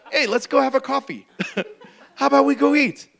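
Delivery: laughing through a cheerful invitation; casual, laughing friendly offer; giggling buddy invite. Pretend. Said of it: "Instead, he's like".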